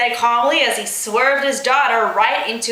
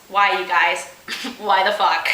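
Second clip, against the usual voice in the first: usual speaking voice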